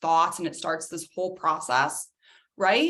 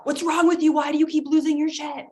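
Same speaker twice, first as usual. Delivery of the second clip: shaming yell; 7 semitones higher, 4 dB louder than usual. Acted. Yelling at someone's outburst.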